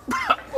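high squealing laugh after a strong shot; real laugh after a harsh drink; shocked high laugh. Real, not acted. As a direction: burst into a shrieking laugh.

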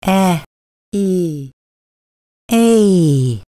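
E, E, then A, gliding from E to E into A; vowel glide demo. Shown as a demonstration. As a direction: sound out A as two joined vowels. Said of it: "A is made of two sounds".

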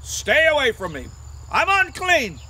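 acted leper's call; called-out warning. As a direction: warn passersby off loudly. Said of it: "they always had to say".

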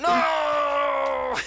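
high loud cry of dismay; anguished shout. Real, not acted. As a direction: cry out as you fall. Falls in the game.

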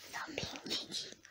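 whispered playful scolding. Real, not acted. Mum playfully scolding baby.

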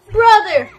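loud, high call; mock anguished cry. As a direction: cry out theatrically for a lost brother. Mock grief for dead robot.

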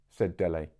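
usual speaking voice

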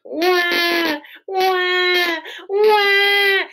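high, loud fake baby wail; hammed-up crying for the kids; loud mock wailing. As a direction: wail like a toddler having a meltdown. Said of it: "like she had a microphone when she would cry".

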